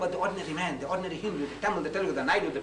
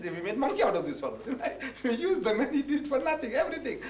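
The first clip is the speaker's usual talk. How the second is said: a gloating quote that breaks into laughter, higher; mocking laugh; 6 semitones higher than usual. Arrogant Rama gloating over the unpaid helpers, mock laughing. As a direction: laugh through it like the gloating king. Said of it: "he said".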